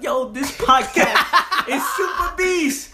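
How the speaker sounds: mock hype. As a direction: pump it up in fun. Mock hyped listener.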